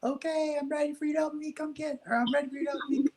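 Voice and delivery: put-on high, little boy's voice calling to mom; child calling out. Boy calling to his mom from his bedroom, high child's voice. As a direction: call to mom as a small boy. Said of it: "I'd yell to my mom".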